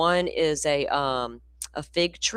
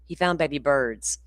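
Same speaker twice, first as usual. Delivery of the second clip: ordinary talk, nothing marked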